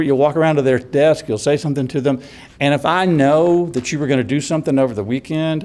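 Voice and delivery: usual speaking voice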